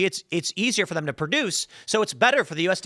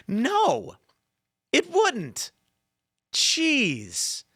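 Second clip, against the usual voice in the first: "It wouldn't" shot way up in disbelief; high, scoffing disbelief; a real no-way reaction to the chatbot's answer; incredulous; 9 semitones higher, much slower than usual. Real, not acted.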